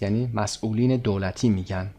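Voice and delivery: ordinary talk, nothing marked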